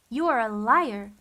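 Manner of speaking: loud venomous accusation; hissed. A narrator's reading. Olaf accusing Violet, loud venomous hiss. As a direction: spit the accusation at her. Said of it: "he hissed at Violet".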